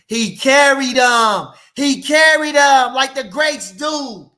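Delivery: carried him, hammered out loud; emphatic shout. Real, not acted. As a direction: shout it out like a preacher. Repeated louder for emphasis.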